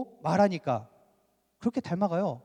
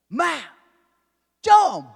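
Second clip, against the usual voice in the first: abrupt, loud Gyeongsang outburst; angry Gyeongsang dialect; 8 semitones higher, 9 dB louder, much slower than usual. Shown as a demonstration. Preacher showing how Gyeongsang people react when mad, angry Gyeongsang dialect. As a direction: imitate an angry Gyeongsang man, high and loud. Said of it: "경상도 어떻게 합니까".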